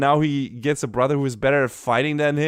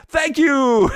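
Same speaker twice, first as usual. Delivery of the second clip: grateful character voice; 11 semitones higher, 5 dB louder than usual. Retold or imitated. Du thanked when someone is nice.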